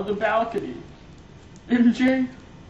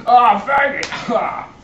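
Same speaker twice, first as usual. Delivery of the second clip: loud, higher cry of pain; pained outcry; 5 semitones higher, 6 dB louder than usual. Acted. Hurt villain crying out at Peter, loud. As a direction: yelp it out as if struck.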